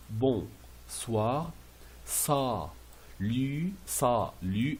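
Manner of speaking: "bon soir", "ça, lu" with a pause after each syllable; syllable-by-syllable model. Shown as a demonstration. Teacher showing pronunciation, syllable by syllable. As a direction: split "ça, lu" into two clear beats. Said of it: "c'est pour la prononciation".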